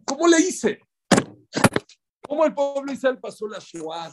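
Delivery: mucho más agudo, asombrado; asombro lloroso. Acted. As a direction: como quien no cree lo que superó. Los tzadikim lloran asombrados. (los justos, asombrados, preguntan cómo lo lograron)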